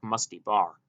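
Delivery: plain reading voice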